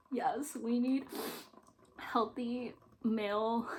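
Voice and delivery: ordinary delivery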